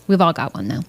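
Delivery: normal talking voice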